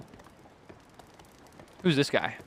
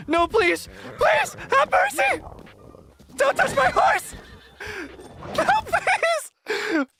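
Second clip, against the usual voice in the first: frantic pleading; much slower than usual. Acted. Villager begging the attacker to spare her horse, shrieking. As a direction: beg and scold in a panicked high voice.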